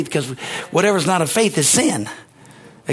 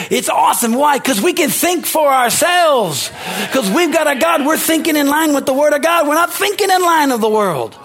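full-force preaching; 9 semitones higher than usual. Real, not acted. Pastor to Harvest Bible Church, preaching at full force. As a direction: preach it out at the top of the voice.

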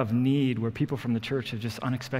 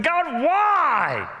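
much higher, louder anguished plea; desperate cry out; 14 semitones higher, 6 dB louder than usual. Retold or imitated. Hunter crying out to God in despair, anguished cry. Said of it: "I just cried out".

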